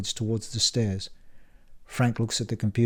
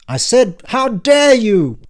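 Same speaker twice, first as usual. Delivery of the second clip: much higher, loud, slow furious repeat; furious yell; 12 semitones higher, 11 dB louder, slower than usual. Acted. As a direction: hurl "how dare you" with more fury. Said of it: "stop yelling".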